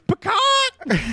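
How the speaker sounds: high yelp; mock exclamation. Lawyer blurting out the word pecan, high yelp. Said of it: "the lawyer on trial".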